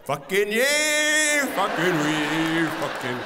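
put-on slow, loud, drawn-out kid whoop; hammed-up child shouting slowly; mock kid cheer. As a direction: play a wild little boy cheering. Imitating son cheering.

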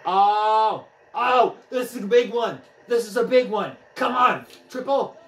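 high, loud, rising excitement; excited. Real, not acted. Player urges a big hit toward a triple, excited. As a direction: cheer the hit on urgently.

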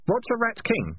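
high, pointed demand; sharply questioning. A narrator's reading. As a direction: press for an answer. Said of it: "said Keith sharply".

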